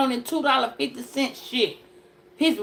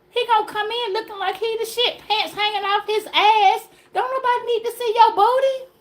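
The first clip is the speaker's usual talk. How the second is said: pitched up, riled mocking; high mocking rant; 9 semitones higher than usual. Real, not acted. Mocking a guy with sagging pants, high rant.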